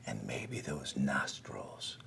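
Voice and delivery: low, hushed whisper of a lurking threat; storyteller hushing kids while the gator lurks; suspense whisper. A narrator's reading. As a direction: lean in and whisper the danger.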